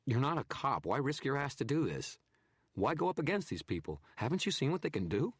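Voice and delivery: loud, incredulous questioning; incredulous photographer. A narrator's reading. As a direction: demand why he risks it. Narrator voices photographer.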